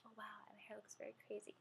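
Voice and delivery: whispered aside; whispering. Real, not acted. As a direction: whisper to yourself. Aside about her hair.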